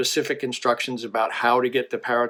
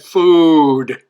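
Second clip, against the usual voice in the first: loud, drawn-out parrot's plea; loud punchline cry; 4 semitones higher, 12 dB louder than usual. Acted. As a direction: call it out long and loud. Punchline, dying parrot's cry for food.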